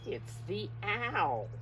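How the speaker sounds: ordinary talk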